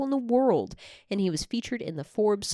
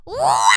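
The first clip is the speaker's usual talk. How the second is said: low, loud Toad-style squawk; [name] doing Toad; 8 semitones lower, 6 dB louder than usual. Acted. Showing off an impression of an impression, Toad squawk. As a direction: squawk like a pop star imitating Toad. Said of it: "my [name] doing Toad impression".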